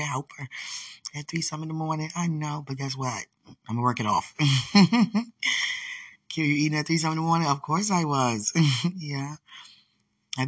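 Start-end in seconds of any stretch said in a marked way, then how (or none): 4.4-6.0 high burst of laughter; loud laugh. Real, not acted. Laughing about working off a late snack.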